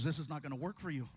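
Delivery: low, quick doctor voice; doctor's verdict. Retold or imitated. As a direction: deliver the verdict deep and fast. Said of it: "He goes".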